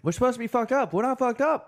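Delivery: high squeaky voice. Retold or imitated. Friends joking about not being drunk, high squeak. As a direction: squeak it out in a silly voice. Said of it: "Bubs-like protest".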